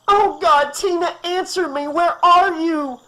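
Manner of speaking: very high, loud, frantic screech; mother screeching for her missing daughter. Acted. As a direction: scream it out in panic. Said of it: "Amanda screeched".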